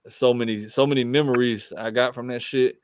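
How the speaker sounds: ordinary delivery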